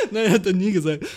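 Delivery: laughing through the words; real laughter over the words. Real, not acted. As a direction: crack up while admitting it. Laughing at own joke.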